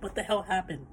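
high, soft, just-woken confusion; jolted out of a nap, disoriented; groggy surprise on waking from a golf nap; startled. Retold or imitated. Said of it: "I'm like".

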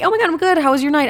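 high-pitched chatty reply; normal friendly person. Retold or imitated. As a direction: imitate polite, upbeat small talk. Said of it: "Any normal person".

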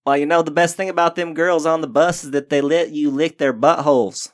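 hammed-up Texas good ol' boy; mock Texas accent. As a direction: put on a Texas twang. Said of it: "I can do Texas".

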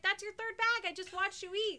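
mock scolding. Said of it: "I was like".

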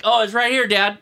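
put-on high, loud, eager kid's reply; imitating son. Son proudly showing dad the lost part, high and loud. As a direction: play the helpful son piping up. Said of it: "Ben's like".

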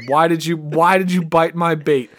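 ordinary talk, nothing marked

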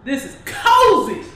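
show announcer. Acted.

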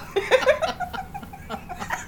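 squeaky laugh up high; helpless high giggle; high squeal of laughter. Real, not acted.

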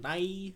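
"Nye" said with an odd ee sound at the end; weird E ending. Shown as a demonstration. Showing co-host the odd name, "Nye" ending in ee. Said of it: "this weird kinda E at the end".

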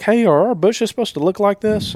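high, concerned customer voice; worried client. Retold or imitated. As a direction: voice a puzzled bank client.

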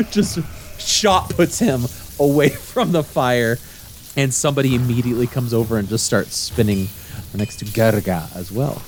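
ordinary delivery

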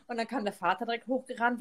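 usual speaking voice

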